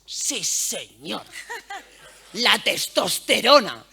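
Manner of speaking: loud, slow, laughing bro chant; mock macho cheer. Coworkers cheer a guy's boast, mock loud laughing. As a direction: ham up a crowd of guys cheering.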